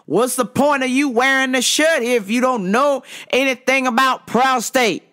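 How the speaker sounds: high, mock indignant rant; aggressive customer. Customer berating a cashier about vitamins, aggressively. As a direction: imitate a man loudly fed up with staff. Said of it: "the attitude is very aggressive".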